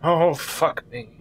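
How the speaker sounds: slow, weary groaning curse; exasperated. Acted. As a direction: sigh it out, fed up.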